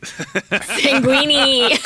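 word said through laughter; laughing. Real, not acted. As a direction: laugh it out, slowly. Laughing at the pun.

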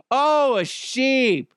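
high, drawn-out mock wonder; mock amazement. Podcaster reacting to the sheep disguise, mock amazement. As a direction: marvel slowly with fake surprise.

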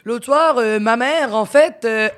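a hint of a Parisian comic; French accent. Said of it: "Il fait". (léger accent français imité)

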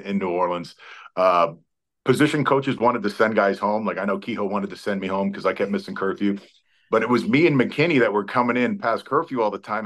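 usual speaking voice

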